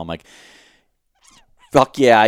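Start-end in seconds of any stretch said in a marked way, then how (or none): 1.7-2.2 louder, higher victory cheer, reenacted; half-scale celebratory holler; retold yell. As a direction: imitate yelling in triumph. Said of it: "I start screaming and yelling".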